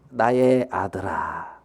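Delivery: slow, low, soft address; drawn-out, softened fatherly call; tender father. Acted. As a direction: gently soothe an angry son. Father soothes angry elder son.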